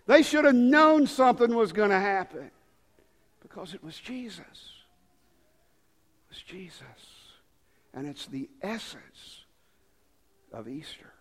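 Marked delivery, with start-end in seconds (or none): 3.4-11.1 very soft, near whisper; hushed reverence. Real, not acted. Preacher names the essence of Easter, hushed. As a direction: drop to a reverent hush.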